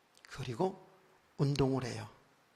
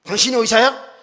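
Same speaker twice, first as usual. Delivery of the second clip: loud, high indignant challenge; mock-angry scolding question; imitated rebuke; 9 semitones higher, 15 dB louder than usual. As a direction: tear into them like an irate doctor. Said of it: "이렇게 막 책망하는 거예요".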